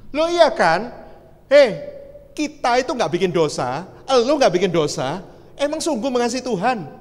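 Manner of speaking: high, loud, pointed questioning; insistent challenge. Retold or imitated. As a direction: press them with each question. Challenges the man's claimed love.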